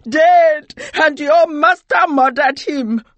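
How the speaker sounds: high, furious shout of grief; loud, wailing accusation; anguished cry. A narrator's reading. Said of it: "she cried".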